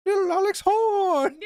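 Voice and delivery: Welsh TV host, rising to a high shout; mock Welsh presenter building to a shriek; Greg Davis. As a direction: announce the show in a Welsh accent, then screech the name. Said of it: "Taskmaster. Greg Davis is hilarious, and he is, uh, from Wales".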